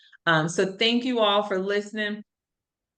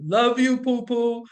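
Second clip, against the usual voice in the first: deep voice; 4 semitones higher than usual. Shown as a demonstration. Mom showing her boys a masculine way to say it, deep voice. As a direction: put on a mock deep, manly voice. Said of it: "I would say it in my deep voice".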